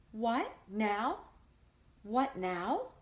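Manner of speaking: a touch of whimper; mock sobbing flower girl. A narrator's reading. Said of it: "sobbed the flower girl".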